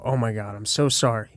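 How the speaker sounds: low, soft pretend concern; fake sympathy. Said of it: "that's my fake listening".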